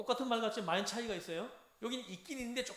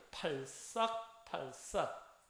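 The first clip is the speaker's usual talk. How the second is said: very slow, drawn-out slapping wave word; wave-sound demonstration; 3 semitones lower, much slower than usual. Shown as a demonstration. Teacher has class repeat the wave sound, very slow demonstration. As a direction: stretch each 팔싹 out slowly for repeating. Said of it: "따라해요".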